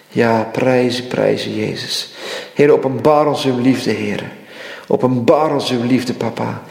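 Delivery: normal talking voice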